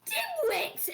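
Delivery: very high shrieked insult. Acted. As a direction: shriek the insult after him. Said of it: "She goes".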